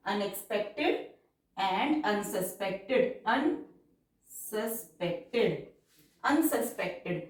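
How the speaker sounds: very slow, drawn-out dictation pace; stenography dictation, stretched syllables. Shown as a demonstration. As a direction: read it out word by word for shorthand. Dictation repeated.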